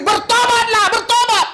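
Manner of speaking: high, loud shouted call to repent; harsh-sounding call. Shown as a demonstration. Preacher showing a pastor shouting at sinners to repent. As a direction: yell it like a fiery preacher. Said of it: "kasar pendeta itu bagi Tuhan, enggak, itu halus".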